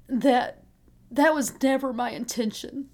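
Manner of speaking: fighting back real tears, pitch up; struggling to get the words out; voice breaking. Real, not acted. Mother telling her son she never meant it, choked up. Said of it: "even talking about it now is hard".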